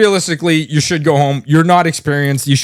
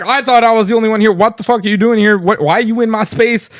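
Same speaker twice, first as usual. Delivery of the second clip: higher, fast, mock-panicked rant; retold freak-out; 6 semitones higher than usual. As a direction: rattle it off like a startled loner. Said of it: "And he started freaking out".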